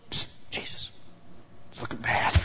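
mock worried whisper. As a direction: nudge Jesus in a whisper that things look bad. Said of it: "and I can go".

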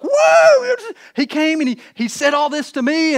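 hammed-up, high, loud gushing; mock a wife bursting in with news; extremely excited. Wife tells husband about visitor, mock excitement. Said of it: "his wife comes extremely excited".

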